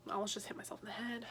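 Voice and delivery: quick whisper to herself; real hushed mutter, fast; whispered aside. Real, not acted. Quick aside after nearly hitting herself.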